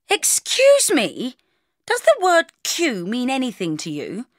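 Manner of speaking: high, slow haughty rebuke; hoity-toity. Acted. Bridget to a queue-jumper, hoity-toity. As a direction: put on a snooty, drawn-out voice. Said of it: "I said in a hoity-toity voice".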